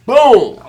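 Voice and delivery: loud high boom; punch sound effect. Retold or imitated. Telling the punch story, a loud high boom.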